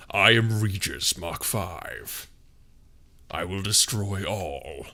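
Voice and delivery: low, slow menacing machine voice; slow deep robotic threat; robot villain voice. Pretend. Robot villain intro in ASMR.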